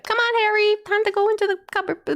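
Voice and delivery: high cheery parent voice; sing-song parent. Pretend. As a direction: sweetly send him off. Voicing parent to Harry.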